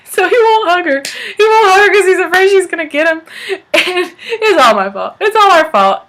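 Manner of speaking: high, loud, talking through laughter; real laughing. Real, not acted. Teller confessing the family scared Adam, high laughing voice. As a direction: squeal it out while cracking up.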